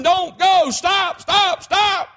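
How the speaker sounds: high, urgent shouts; yelled warning. Acted. Watchman warning a family off the tracks, urgent yelling. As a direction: yell to halt an oncoming car. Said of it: "I was yelling".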